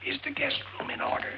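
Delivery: voiceless whispered question. Acted. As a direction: ask in a hoarse whisper. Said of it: "What are you whispering about?".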